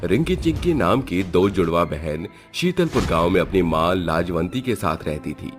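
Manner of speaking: usual speaking voice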